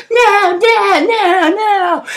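high, sing-song mock wail; imitated high cries of protest; possessed wailing. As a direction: wail it out like the girl resisting exorcism. Possessed girl resists the exorcism.

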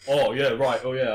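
loud, hurried backing-down voice; retold flustered manager. The manager backing down, flustered agreement. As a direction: cave in quickly.

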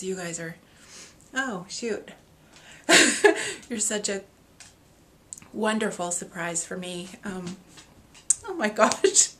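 usual speaking voice

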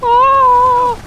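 sudden high yelp of shock; a real jolt of surprise, loud; startled shout. Real, not acted.

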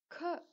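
க with a burst of air; aspirated k. Shown as a demonstration. Teacher showing the paper test, க strongly aspirated. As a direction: make paper flutter with க. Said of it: "பேப்பரை வச்சோம்னா அது ஆடும்".

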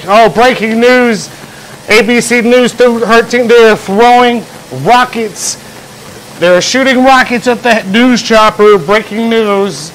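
high, slow hammed-up news anchor voice; mock breaking news. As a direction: play an overexcited TV reporter breaking a story. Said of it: "then they'd be like".

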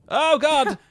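sudden panicked yell; startled scream. Real, not acted. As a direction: shriek in fright. Monster jumps out.

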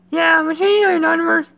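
high put-on voice; high playful character reading. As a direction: lift the voice way up for the character. Voicing game character.

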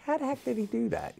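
high, mock baffled question; amazed audience. Audience members baffled by the magician's trick, asking in high mock amazement. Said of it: "the people say".

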